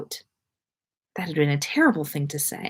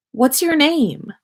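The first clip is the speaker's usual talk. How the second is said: put-on curious girl; 9 semitones higher, slower than usual. A narrator's reading. Hannah asks shyly.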